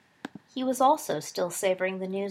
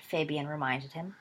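ordinary delivery

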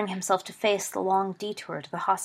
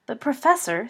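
higher-pitched mild complaint; complaining; 5 semitones higher, 4 dB louder than usual. A narrator's reading. Pupil objecting to his professor, complaining. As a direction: protest to the teacher in a raised pitch. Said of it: "Sirius complained".

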